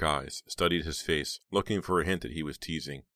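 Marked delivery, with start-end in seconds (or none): none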